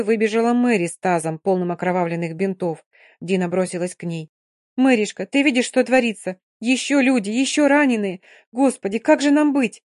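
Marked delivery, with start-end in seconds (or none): 4.8-9.8 high, loud anguished appeal; distraught. Acted. Dina anguished, pleading to Mary. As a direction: cry out to her friend in despair.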